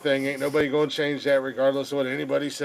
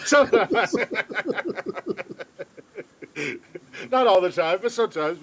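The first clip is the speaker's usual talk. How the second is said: laughing; 6 semitones higher than usual. Real, not acted. Echo back laughing.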